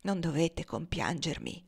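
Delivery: hushed, grave reassurance; quietly and solemnly refuse pity. A narrator's reading. Dying man to his grieving young master, solemnly. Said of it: "disse Tom solennemente".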